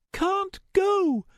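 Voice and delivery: high, firm refusal; high girl voice. Acted. Starbreeze refuses.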